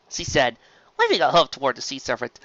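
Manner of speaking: usual speaking voice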